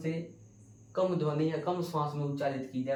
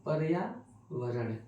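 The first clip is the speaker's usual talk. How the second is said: पर्यावरण spelled out slowly, syllable by syllable; teacher slowly modelling पर्यावरण for students; slow demo; much slower than usual. Shown as a demonstration. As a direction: stretch out पर्यावरण so each sound is heard. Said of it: "रो आधा बच गया है तो ऊपर आ गया".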